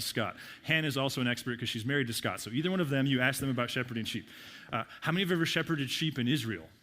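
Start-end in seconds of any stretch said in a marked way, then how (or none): none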